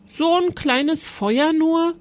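put-on a small boy let down by the tiny fire; disappointed boy. A narrator's reading.

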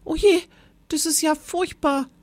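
high, dismayed little voice; a high, fretful oh dear; horrified small teacher. Acted. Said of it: "riss entsetzt die Augen auf".

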